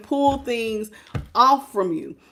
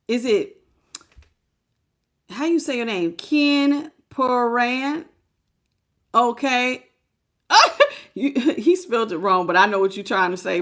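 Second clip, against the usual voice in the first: ordinary talk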